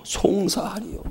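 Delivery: slow soft echo of 송사하리요 with a falling end; repeating the key word. Shown as a demonstration. Preacher repeats 송사하리요 slowly to the congregation, softly. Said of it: "누가 능히 하나님의 택하신 자들을 송사하리요".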